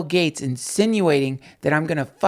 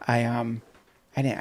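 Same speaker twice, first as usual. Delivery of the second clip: ordinary talk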